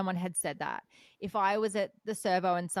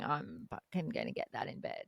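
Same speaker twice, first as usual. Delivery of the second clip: guy's lewd thought; 5 semitones lower, 7 dB quieter than usual. Retold or imitated. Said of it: "in his brain going".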